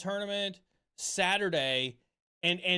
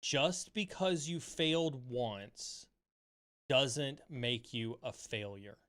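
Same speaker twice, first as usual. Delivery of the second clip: gentle reassurance; 4 semitones lower, 3 dB quieter, slower than usual. Retold or imitated. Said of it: "She said".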